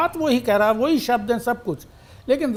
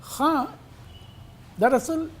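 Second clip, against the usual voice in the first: ordinary talk, nothing marked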